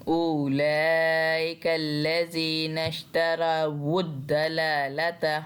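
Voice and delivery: इश्तरावुद्दलालता recited slowly with a heavy, full-mouthed द; slow tajweed recitation, heavy दला; heavy reading. Shown as a demonstration. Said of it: "हमने मोटा पढ़ना है". (इश्तरावुद्दलालता में दला को मोटा और धीमा पढ़ें)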